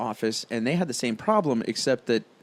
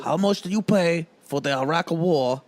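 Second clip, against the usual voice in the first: slow, higher-pitched botched politician impression; bad Bernie Sanders; 5 semitones higher, 3 dB louder, slower than usual. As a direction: drag out a badly faked senator voice. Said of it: "That's my Bernie".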